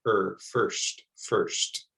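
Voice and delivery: "first" said softly, er vowel, no long I. Shown as a demonstration. Teacher repeating "first" softly for learners. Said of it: "it's a soft sound".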